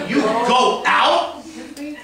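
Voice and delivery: slow, laughing, "out" stretched and lifted; slow question peaking on "out"; stress on OUT. Shown as a demonstration. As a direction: drag it out slowly and lean on "out". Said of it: "on the emphasis on a different word".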